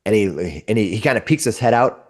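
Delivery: ordinary talk, nothing marked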